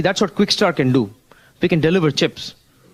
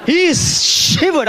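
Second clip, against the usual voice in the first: high, slow laughing words; 12 semitones higher, much slower than usual. Real, not acted. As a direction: laugh it out in disbelief. Laughing at the fired-up guy.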